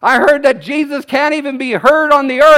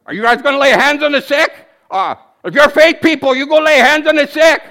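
mock caller; 3 semitones higher than usual. Retold mocking phone caller.

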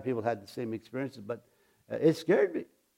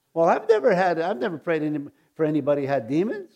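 ordinary delivery

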